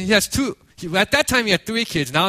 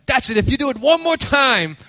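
raised, higher-pitched parental threat; retold fed-up warning; 6 semitones higher, 4 dB louder than usual. Fed-up father warning his daughter, sharply raised voice. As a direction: act out a parent at the limit. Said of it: "he was so fed up".

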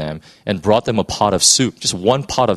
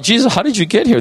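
much higher, wide-eyed puzzled asking; amazed question; 12 semitones higher, faster than usual. Acted. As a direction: ask in disbelief, voice jumping up. Disciples astonished at Jesus.

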